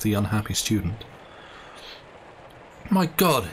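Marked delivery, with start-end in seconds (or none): 2.9-3.5 a high cry of despair; anguished cry. Acted. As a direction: cry out as the trap closes. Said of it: "he cried".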